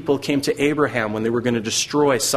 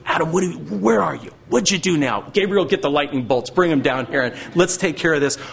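mock angry God, higher and pushing; 5 semitones higher than usual. Preacher imitating an angry God calling Adam, hammed-up fury. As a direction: ham up a fed-up God calling for lightning. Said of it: "Do you think he was angry?".

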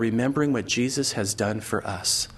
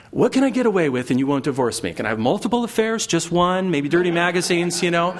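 higher, quick, louder run of bargaining questions; mock pleading spouse; 5 semitones higher, 4 dB louder than usual. Cheating spouse asking what is allowed, mock bargaining. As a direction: haggle eagerly, pushing for more.

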